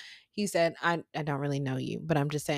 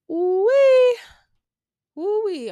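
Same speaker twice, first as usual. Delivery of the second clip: high squealing ooh-wee, much louder; a loud high-pitched ooh-wee of alarm; 9 dB louder, much slower than usual. Retold or imitated. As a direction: squeal it like dreading trouble. Dreading the fallout, squealing.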